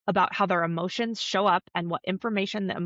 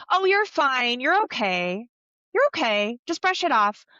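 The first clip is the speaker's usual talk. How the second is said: louder, brisk brushing-off, acted; dismissive mom; 5 semitones higher, 4 dB louder than usual. Mom to toddler who fell, acted breezy dismissal. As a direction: wave the hurt away, mock breezy. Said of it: "discount it and be like".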